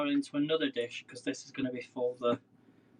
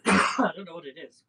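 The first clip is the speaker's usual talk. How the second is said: real cough, then words; throat tickled, coughing; 3 semitones higher, 8 dB louder than usual. Real, not acted. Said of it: "making me cough a little bit".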